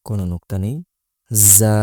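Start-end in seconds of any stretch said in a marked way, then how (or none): 1.6-1.8 জা with a buzzing z and held vowel; drawn-out জা. Shown as a demonstration. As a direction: buzz the start of জা, hold it briefly. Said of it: "এক আলিফ পরিমাণ টান". (জা এক আলিফ টেনে)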